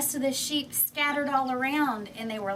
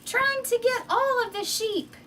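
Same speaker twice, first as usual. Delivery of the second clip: high, loud scream for help; panicked scream; 7 dB louder than usual. Acted. Shepherd boy alone with the wolf, screaming for help. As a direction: scream it, terrified, as the wolf attacks. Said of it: "started screaming".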